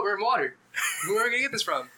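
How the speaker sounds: acted-out disbelief; incredulous protest. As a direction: question the absurd plan, voice climbing. Incredulous retort to campfire idea.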